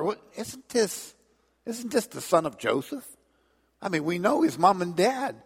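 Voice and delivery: high, incredulous and mocking; scoffing crowd. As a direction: scoff at him in disbelief. Crowd doubting Jesus.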